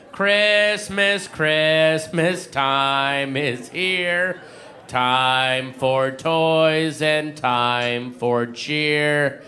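slow, low monotone singing; slowed-down chipmunks. Retold or imitated. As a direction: sing it like a record played too slow. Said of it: "sound like normal, monotone guys".

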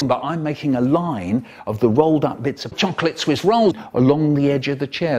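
ordinary talk

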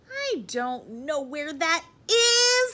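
high, loud, slow protest; childish wail. A narrator's reading. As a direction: draw it out like a helpless child. Froggy whining back to his father.

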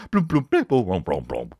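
vocal imitation of quirky scene music; mock TV music cue. Mocking Grey's Anatomy's music, plinky imitated cue.

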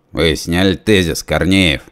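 plain reading voice

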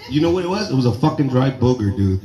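ordinary talk, nothing marked

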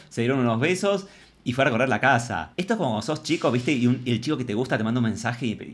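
ordinary delivery

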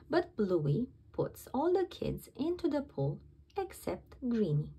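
plain reading voice

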